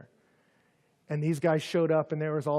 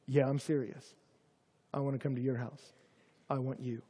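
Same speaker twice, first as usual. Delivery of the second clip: softer, lower, tender reply; gentle reassurance; 3 semitones lower, 6 dB quieter than usual. Acted. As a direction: quietly assure him he's wanted. Jesus answers Zacchaeus gently.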